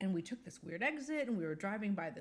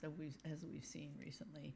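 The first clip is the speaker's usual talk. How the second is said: whisper; 4 semitones lower, 13 dB quieter than usual. Real, not acted. Wry aside about recent events.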